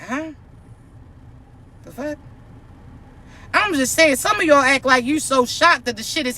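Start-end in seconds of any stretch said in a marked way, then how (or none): 0.0-2.2 soft baffled huh, under the breath; quietly baffled, reacting to what they hear; confused huh. Baffled reaction while listening to gossip. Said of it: "I really be pausing sometimes".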